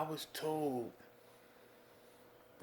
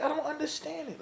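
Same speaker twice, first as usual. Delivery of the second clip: usual speaking voice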